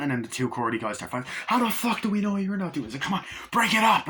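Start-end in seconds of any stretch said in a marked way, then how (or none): 1.5-2.9 high, raised accusing yell; a suspicious man shouting; mock angry yell. Crewman accuses another during a fight, high angry yell. Retold fight between crew. 3.0-4.1 high, loud break-it-up shout; separating fighting men; mock shout. Someone breaks up the fighting crewmen, high loud shout.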